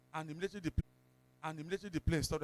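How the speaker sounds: normal talking voice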